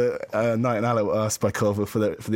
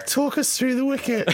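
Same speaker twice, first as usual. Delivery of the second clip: high-pitched mock interviewer; mimicked interviewer; 13 semitones higher than usual. Mimicking the clip's interviewer, high mock voice. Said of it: "I thought he was just like".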